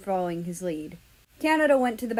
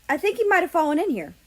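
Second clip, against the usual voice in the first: high, fast excited line; excited rush; 9 semitones higher, 5 dB louder, faster than usual. Acted. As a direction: blurt it out eagerly.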